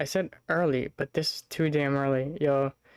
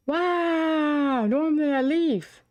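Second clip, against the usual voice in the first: high mock wow; 12 semitones higher, much slower than usual. Mock dramatic exclamation.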